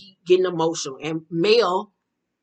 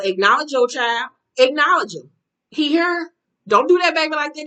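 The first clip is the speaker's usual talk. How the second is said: raised voice, climbing higher as it goes; indignant scolding; 6 semitones higher, 4 dB louder than usual. Real, not acted. Scolding a father about his baby, indignant. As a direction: tell him off, louder and higher, insistent.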